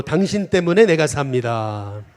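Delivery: preacher leading a call-and-response; slow call to repeat. Shown as a demonstration. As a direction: declaim it slowly so everyone can repeat it. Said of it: "또 마지막으로".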